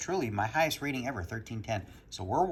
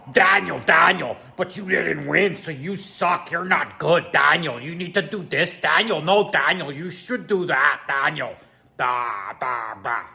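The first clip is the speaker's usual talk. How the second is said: louder, whiny nagging voice, stretched names; nagging internet voice; 3 semitones higher, 6 dB louder, slower than usual. Retold or imitated. Vlogger mocks internet comments scolding him, in a whiny nagging voice. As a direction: needle him like a smug online commenter. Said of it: "That's my impression of what the internet would sound like".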